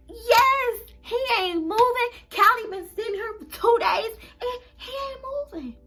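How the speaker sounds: high, loud tearful wail; telling sister the guinea pig is dead, wailing; crying to sister. Retold or imitated. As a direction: wail that he is really gone, close to sobbing. Tells sister pet is dead.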